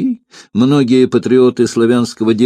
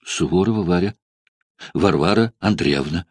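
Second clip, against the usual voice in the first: frightened whisper; 4 semitones lower, 4 dB quieter than usual. A narrator's reading. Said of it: "испуганно прошептала Варя".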